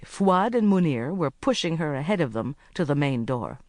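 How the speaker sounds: usual speaking voice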